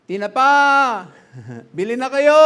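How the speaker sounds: sing-song vendor shout; street vendor calling out. Retold or imitated. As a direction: call out your fish for sale down the street. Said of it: "Tapos sumisigaw".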